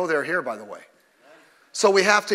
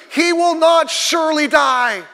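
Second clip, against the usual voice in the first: high, loud, slow shout; booming, slow preaching shout; 10 semitones higher, 7 dB louder, slower than usual. Real, not acted. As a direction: preach it at full force. Shouted emphasis.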